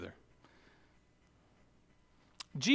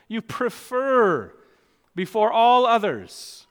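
high, loud pulpit proclamation; forceful preaching; 7 semitones higher, 7 dB louder than usual. Real, not acted. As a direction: drive each point home from the pulpit.

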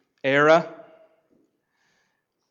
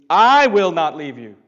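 much louder, ringing declaration; emphatic proclamation; 3 semitones higher, 10 dB louder than usual. Acted. Pastor proclaiming Jesus' promise to the disciples, loud.

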